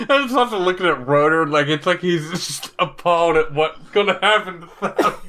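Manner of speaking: talking through giggles; laughing. Real, not acted. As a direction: laugh it out as you speak. Laughing at comic panel.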